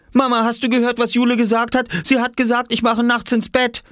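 put-on high-pitched child tattling; indignant little boy. Oskar telling Mama on his sister, indignant. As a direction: whine to Mum in a very high voice.

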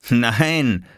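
lightly laughed denial; amused. Acted. Viktor answering Oskar's suspicion, lightly amused. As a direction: brush it off with a touch of laughter. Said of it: "lachte Viktor".

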